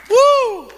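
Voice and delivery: loud, high joyful woo; excited whoop. Real, not acted. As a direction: let out a celebratory whoop. Preacher whoops.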